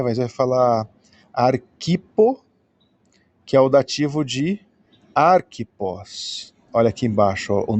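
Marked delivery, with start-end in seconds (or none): 1.3-2.4 "arquipo" said very slowly, higher, as ar-ki-po; careful slow Greek. Shown as a demonstration. Teacher showing the class the dative "arquipo", slowly. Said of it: "teacher sounding out the Greek name". 5.1-6.5 "arquipos" drawn out very slowly, ending in -pos; slow Greek demo. Shown as a demonstration. Teacher contrasting it with the dative, slow and careful. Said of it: "nominative form sounded out".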